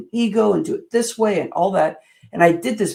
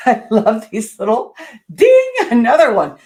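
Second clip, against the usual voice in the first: laughing; 4 semitones higher, 9 dB louder than usual. Real, not acted. Laughing at a chime sound.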